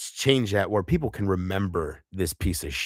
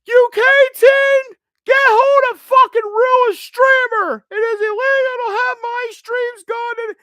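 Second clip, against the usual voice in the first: mock rant, shrieked far higher and louder, dragged out slow; exaggerated screechy rant, slow and very high; imitating her ranting; 9 dB louder, slower than usual. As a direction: parody a streamer's furious rant in a squealing put-on voice. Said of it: "And she would rant".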